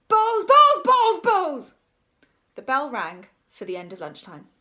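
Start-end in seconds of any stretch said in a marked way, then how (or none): 0.1-1.7 high, very loud repeated shouts; gone bananas. A narrator's reading. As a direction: shout it over and over like a madman. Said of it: "as if he'd gone bananas".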